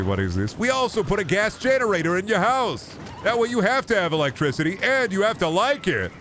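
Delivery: high, chipper pitchman voice pushing gadgets; bright mock-salesman delivery, pitched well up; cheery modern salesman. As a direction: sell the upgrades like an eager, smug tech bro.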